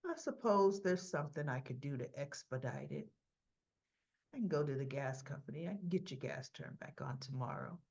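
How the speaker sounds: lightly voiced gas inspector; a hint of a low, obliging inspector. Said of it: "he goes".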